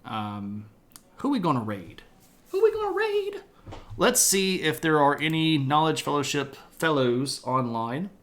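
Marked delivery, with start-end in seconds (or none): 2.5-3.4 much higher, playful squeak; silly high-pitched question; high squeaky repeat. Pretend. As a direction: ask it again in a tiny high voice.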